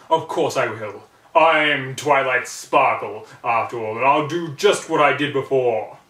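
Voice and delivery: loud arrogant proclamation; arrogant call. A narrator's reading. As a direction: boast to the frightened friends. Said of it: "The arrogant pony called out".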